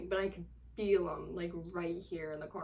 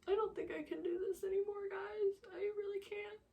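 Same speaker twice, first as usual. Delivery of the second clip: higher, tight voice close to crying; strained higher near-tearful plea; on the verge of tears; 12 semitones higher, 4 dB quieter than usual. Real, not acted. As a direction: hold back tears as you plead. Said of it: "makes me wanna cry".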